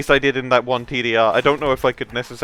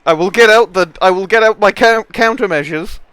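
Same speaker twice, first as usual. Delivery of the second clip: lightly put-on British accent, louder; 5 semitones higher, 6 dB louder than usual. Player threatening rivals in a British accent. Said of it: "It's a British accent".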